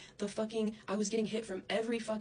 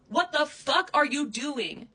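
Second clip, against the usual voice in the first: louder, higher, slow furious shout; 8 semitones higher, 10 dB louder, slower than usual. Real, not acted. Repeating the demand at Krissa, now screaming. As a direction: scream the question at her, slowly.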